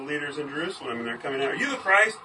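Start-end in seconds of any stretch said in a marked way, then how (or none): 1.6-2.2 high, loud incredulous challenge; mock startled question. Leaders demanding of John, a high loud mock challenge. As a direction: fire it at him like a startled official.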